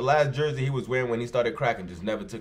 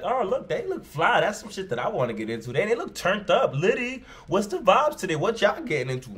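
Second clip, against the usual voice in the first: high, hyped-up put-on voice, mocking; sarcastic impression of someone thinking crack users look cool; mock admiring junkie; 7 semitones higher than usual. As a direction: ham up a starstruck fan of crackheads.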